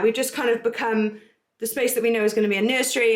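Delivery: ordinary talk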